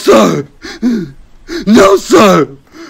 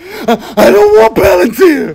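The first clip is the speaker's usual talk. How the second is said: half laughing half crying; 10 semitones higher than usual. Acted. Recruit breaking under abuse.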